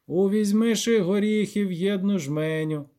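lightly sing-song granny reader; old granny reading aloud. Acted. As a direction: recite an old book with drawn-out phrases.

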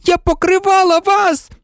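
very high, outraged screech; high-pitched furious protest. A narrator's reading. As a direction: screech it like a betrayed girl. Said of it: "заверещала Герміона".

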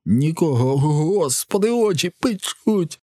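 high, slowing wail of burning pain. A narrator's reading. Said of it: "на волання цього типа". (болісне високе волання)

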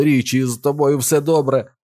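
high, urgent question; anxious shout. A narrator's reading. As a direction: call out to check he is okay. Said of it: "закричав Біл".

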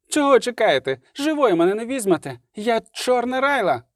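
high-pitched defiant challenge; wild cry. A narrator's reading. Surrounded Райла defying the elves, wild high cry. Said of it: "крикнула вона дико".

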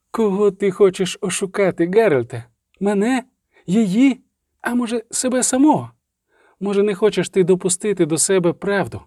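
вищий, наполегливий голос чародійки, що звинувачує; тисни запитаннями, не даючи себе урвати; дедалі напористіше. A narrator's reading. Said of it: "говорила все швидше, усе голосніше". (запальний докір високим голосом)

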